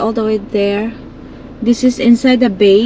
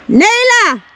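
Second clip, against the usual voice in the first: calling out a name; 11 semitones higher than usual. Real, not acted. Calling the escaping dog.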